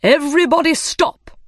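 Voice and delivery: high, loud command from the dodo; crying out. Acted. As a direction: call a halt to a whole crowd. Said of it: "the dodo cried".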